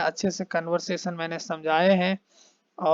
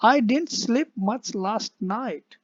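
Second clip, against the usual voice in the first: slow read; 4 semitones higher, much slower than usual. Shown as a demonstration. Teacher reads slowly.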